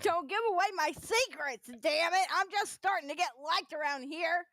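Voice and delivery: high-pitched playful complaint; mock protest. Host just starting to get liked, high mock protest. Jokey protest repeated in higher voice.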